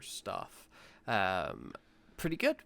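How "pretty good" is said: much higher, mock-smug catchphrase voice; put-on catchphrase voice. Host riffing a catchphrase to his co-host, high mock-smug voice. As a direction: ham up a pleased comedian's catchphrase, pitched high.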